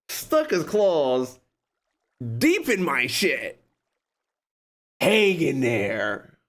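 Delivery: slow, drawn-out emphasis; slow dramatic telling. Real, not acted. Vlogger describing the clawing, slow and drawn-out. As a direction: drag each word out.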